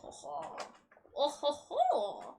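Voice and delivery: light mock French accent, slow; a lightly played French waiter voice; a hint of a French waiter accent. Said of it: "pretended to be a French waiter".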